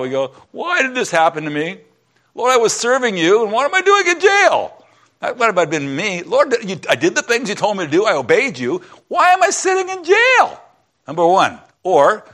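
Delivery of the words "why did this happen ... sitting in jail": high wailing lament, voice cracking upward; whiny complaint. Acted. Preacher acts out a believer wailing in jail. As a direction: cry out to God, wounded and indignant. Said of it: "What's the first thing we do".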